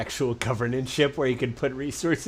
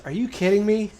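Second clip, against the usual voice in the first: disbelief; 8 semitones higher, 4 dB louder than usual. Retold or imitated. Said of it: "And he goes".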